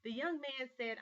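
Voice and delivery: ordinary talk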